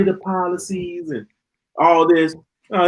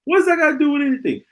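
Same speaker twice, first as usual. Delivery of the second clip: higher and faster, more exasperated; 9 semitones higher, much faster than usual. Real, not acted. Vlogger ranting at foolish posts, more exasperated. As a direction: push the repeat further up. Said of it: "I shouldn't see that foolishness".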